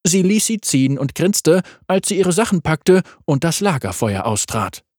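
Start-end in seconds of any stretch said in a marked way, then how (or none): none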